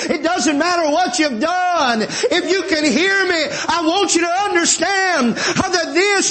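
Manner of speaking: high, ringing preacher's appeal; fervent preaching. Real, not acted. Preacher inviting every hearer with rising fervor. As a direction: plead with the whole room.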